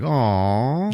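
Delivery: high, drawn-out pitying coo; mock sympathy. Villains react in sympathy.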